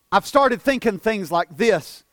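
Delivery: ordinary talk, nothing marked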